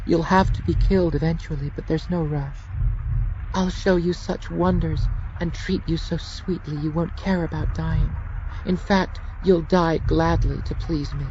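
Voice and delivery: high, sweetly sinister lover's voice; Junie. Acted. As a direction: purr the threat tenderly.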